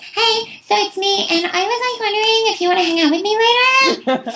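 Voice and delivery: high, silly goofy voice calling a friend; ditzy high-pitched friend on the phone; goofy character voice. Retold or imitated. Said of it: "working on my goofy characters".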